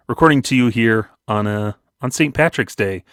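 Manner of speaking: ordinary talk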